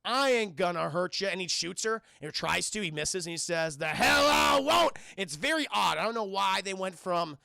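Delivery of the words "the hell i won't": higher, louder, slow cowboy drawl; slow swaggering John Wayne drawl; John Wayne impression. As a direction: ham up a John Wayne line, slow and loud. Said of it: "doing a John Wayne impression".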